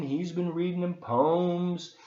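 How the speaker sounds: usual speaking voice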